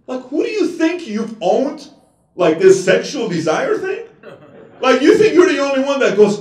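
loud, high, slowed preaching yell; preaching shout. Acted. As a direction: hammer it at the crowd, full force. Preacher yelling at congregation.

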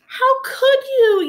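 high, loud, slow tearful cry; distraught high yell. Acted. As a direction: wail it as a hurt little dino. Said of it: "yelled Iguanodon".

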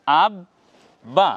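আব then বা, each said separately with a pause; teacher sounds out the pieces for viewers; split syllable demo. Shown as a demonstration. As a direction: spell out আব বা beat by beat. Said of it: "প্রিয় দর্শক বুঝুন".

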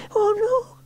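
very high-pitched cry of alarm; high child voice in dismay; girl's dismayed cry. A narrator's reading. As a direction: cry out as a little girl about to crash. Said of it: "cried Sarah".

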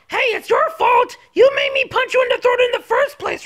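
high, heated accusation; defensive outburst. Acted. Throws the blame back.

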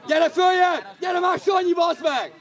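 loud, high yell to players; sideline shout. Real, not acted. Spectator calling Sanyi upfield, shouting. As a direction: shout urgently across the pitch.

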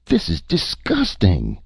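high, slow cry of outrage; acted disgusted protest. A narrator's reading. The chief is appalled by the fighting, crying out. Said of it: "the chief cried".

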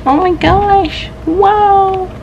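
high, drawn-out amazement; awestruck. Real, not acted. Reader reacting to a new manga volume, awestruck. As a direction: let it out slowly, high and amazed.

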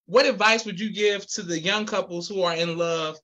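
ordinary talk

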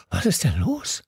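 out of breath while being dragged along; panting. A narrator's reading. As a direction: gasp it out as you run. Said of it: "keuchte ich".

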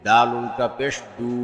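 final "দু" held long; drawn-out vowel. Shown as a demonstration. Teacher demonstrating ulta pesh, long "দু". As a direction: stretch the last "দু" for one alif. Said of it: "একালিপ টেনে পড়তে".

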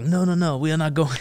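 put-on high, loud French-accented refusal. French reporter refusing to leave under fire, in a French accent. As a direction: imitate a protesting French reporter. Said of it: "I can do a French accent".

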